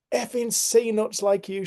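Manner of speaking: high, drawn-out imitation of an irate driver; mock angry shout. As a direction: stretch the insult out, pitched high. Repeats angry driver's insult.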